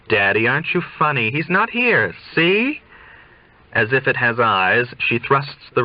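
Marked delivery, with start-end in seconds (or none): 0.1-2.8 impudent joke. Acted. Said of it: "a daughter's impudent joke".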